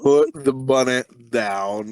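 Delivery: low, drawn-out action-hero drawl; slow, forceful, hammed-up movie line; Nick Cage. Podcaster mimicking an actor's one-liner, low slow impression. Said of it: "Like, Nick Cage, right?".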